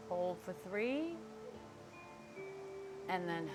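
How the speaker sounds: soft, calm breathing cues; guided breathing. Acted. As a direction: gently talk them through each breath. Leads the breathing exercise.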